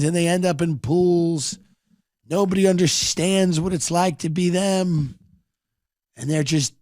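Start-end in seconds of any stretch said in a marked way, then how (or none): none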